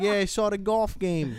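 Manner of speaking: humoring the regular; mock reply. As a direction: drag it out like humoring him. His bored reply.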